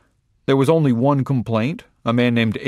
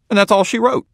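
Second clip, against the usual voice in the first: pitched up and louder, a proud folksy sign-off; high folksy flourish; 11 semitones higher, 5 dB louder than usual. Acted. Caulfield wraps up his puzzle plan, high and proud. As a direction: finish the idea with a high triumphant flourish.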